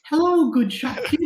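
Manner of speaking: high, sweet noblewoman voice; high elf lady voice. Acted. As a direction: play a dainty elf lady. Player voices elf woman character.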